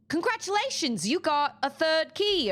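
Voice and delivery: high, chirpy quote of the helper; quoting Miles. Retold or imitated. Retelling what Miles told her, in a mock voice. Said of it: "and Miles was like".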